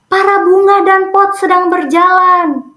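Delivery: high-pitched mock yell in a teasing chant; mock shout. Older students taunting Ayu and friends as they walk past, mock shouting. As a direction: imitate older students calling out, high and sustained. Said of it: "kakak kelasnya pada teriak".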